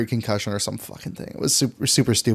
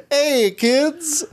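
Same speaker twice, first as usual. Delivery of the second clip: a hint of a high Italian Luigi voice, higher; a lightly voiced Luigi asking kids, higher; failed Italian accent; 10 semitones higher, slower than usual. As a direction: try for Luigi's Italian and miss. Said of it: "I can't do an Italian accent right now".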